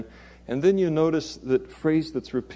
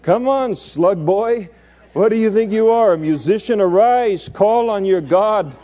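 raised, urgent captain's voice; ship's captain rousing sleeper; 6 semitones higher, 5 dB louder than usual. Acted. Captain urging sleeping Jonah to pray, loud urgent call. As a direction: rouse him loudly, pitched up. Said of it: "says to him".

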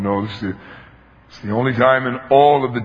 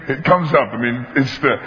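normal talking voice

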